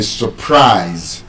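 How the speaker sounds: surprise slowed, with -prise stressed; reduced sur-, full long -prise in surprise; stress on second syllable. Shown as a demonstration. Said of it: "it is stressed on the second syllable".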